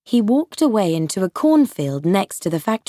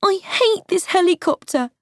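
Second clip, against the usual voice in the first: put-on frightened boy; 11 semitones higher than usual.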